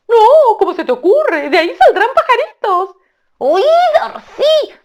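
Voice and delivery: very high, loud, slow bird voice, half sung; a gleeful tailorbird crowing praise; sing-song high loud shout. Acted. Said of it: "dialogue reply".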